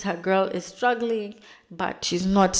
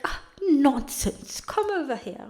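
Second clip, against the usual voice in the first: exaggerated lord's invitation; 7 semitones higher, 4 dB louder than usual. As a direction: wave away the objection in a hammed-up lord's voice. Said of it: "that's not how he speaks".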